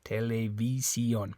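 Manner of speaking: "Televisión" with a pure final O; Spanish televisión. Shown as a demonstration. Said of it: "the O always makes an O sound".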